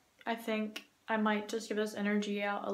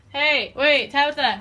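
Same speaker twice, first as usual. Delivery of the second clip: calling out; 5 semitones higher, 10 dB louder than usual. Real, not acted. Calling out to a friend.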